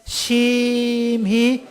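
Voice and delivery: "심히" drawn out very long on one high note. Shown as a demonstration. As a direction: hold "심히" high, as long as one full turn. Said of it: "심히 할 때 한 바퀴 뺑 돌려서". ("심히"를 높은 음으로 길게 늘여서)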